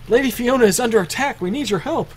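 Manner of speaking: high, urgent servant's voice; panicked servant. Acted. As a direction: plead for help, high and hurried. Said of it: "I'll speak for the servants".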